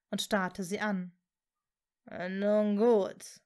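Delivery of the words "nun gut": drawn-out dark lord voice; villainous assent. A narrator's reading. Voldemort accepts Hermine's surrender, menacingly.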